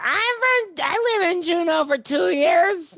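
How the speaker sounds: high, drawn-out mock heckler yelp; slow, squealed interruption; heckler imitation. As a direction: impersonate an oblivious audience member butting in way up high. Imitating an audience member interrupting the comic.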